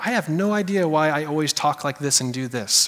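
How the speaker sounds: low, soft puzzled mumble; a lightly voiced confused person; a hint of a muttering believer; mock puzzled self.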